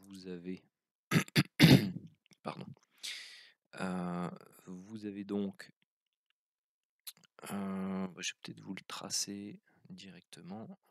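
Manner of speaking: rough, hoarse throat; speaking through a hoarse throat. Real, not acted. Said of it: "donc j'ai la voix enrouée".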